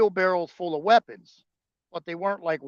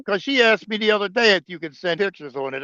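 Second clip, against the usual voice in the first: ordinary delivery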